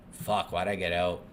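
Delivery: normal talking voice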